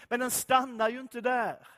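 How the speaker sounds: ordinary delivery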